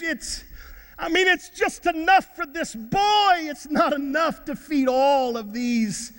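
high-pitched, worked-up objection; exasperated protest. Acted. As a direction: act out a doubter protesting to his master. Acts out Andrew's doubting protest.

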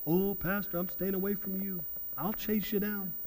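lightly voiced big guy backing away, under the breath; mock country guy. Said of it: "some of you big country guys".

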